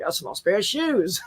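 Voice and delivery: slow, drawn-out mock Australian, ending on a lift; Australian accent. Shown as a demonstration. Host imitating Australians against Americans, slow put-on Australian accent. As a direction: stretch it out in a playful Australian accent. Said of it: "whereas Australians, like".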